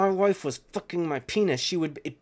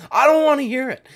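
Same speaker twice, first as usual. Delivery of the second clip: much higher, loud "I don't wanna hear it"; loud outburst; 11 semitones higher, 7 dB louder than usual. Real, not acted. Refusing to hear about his fight style, loud outburst. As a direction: shout it down, fed up.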